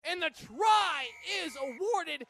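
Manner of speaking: loud, high, drawn-out announcement; excited try call. Real, not acted. Commentator announcing the try is awarded, jubilant and excited. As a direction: stretch out the big scoring moment.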